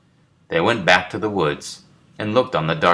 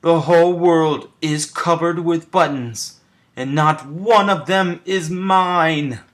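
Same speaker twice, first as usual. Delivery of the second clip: much higher, loud, slowing angry scream; screaming Toad; 11 semitones higher, 5 dB louder, slower than usual. A narrator's reading. Furious Toad jumps up and down screaming about buttons. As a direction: scream it out in a tantrum. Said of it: "jumped up and down and screamed".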